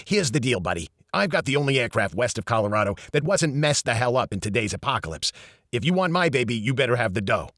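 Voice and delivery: put-on brash New York accent; a fast-talking New York accent, lightly voiced. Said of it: "Eddie spoke with a strong New York accent".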